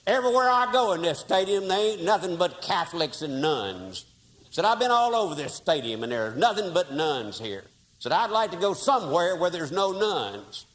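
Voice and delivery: a fed-up cowboy complaining; big Texan grumbling about the nuns; blustering Texan fan. Retold or imitated. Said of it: "this big old Texan".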